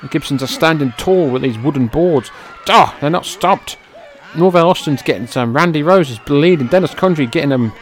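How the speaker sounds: loud, high, shocked play-by-play; excited shouting. Real, not acted. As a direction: yell it out in disbelief. Brawl with wooden boards erupts.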